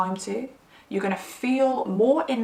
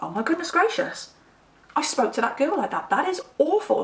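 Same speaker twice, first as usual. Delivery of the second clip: higher, half-mock horror at herself; a light gasp of dismay, imitated; shocked; 6 semitones higher than usual. Waking up appalled at how she spoke to the girl. Horrified at herself next morning.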